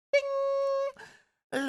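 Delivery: a bright sung ding, very high; ping it like a bell; high ding sound. Acted. Stinger gets sharpened, high ding sound effect.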